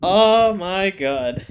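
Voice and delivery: drawn-out, slow oh my god; exasperated. Real, not acted. Reviewer fed up with a character, slow exasperated groan. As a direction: stretch it out in weary disbelief.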